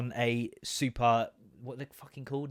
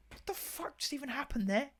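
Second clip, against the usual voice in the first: bewildered; 10 semitones higher, 7 dB quieter than usual. Retold or imitated. Bewildered after the lad strolls off.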